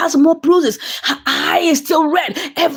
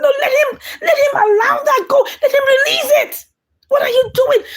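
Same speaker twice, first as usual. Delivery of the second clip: much higher, crying shout; pleading shout; 11 semitones higher than usual. Retold or imitated. He begs her to let the videos go, much higher, crying shout. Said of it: "He said".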